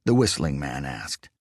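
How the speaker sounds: usual speaking voice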